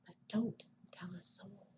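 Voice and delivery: secretive unvoiced whisper. A narrator's reading. Bedtime book line to children, whispered. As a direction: share it like a secret. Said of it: "I'll whisper a secret".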